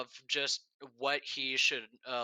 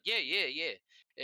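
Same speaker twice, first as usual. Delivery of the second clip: put-on Jamaican accent; much slower than usual. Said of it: "Jamaican accent".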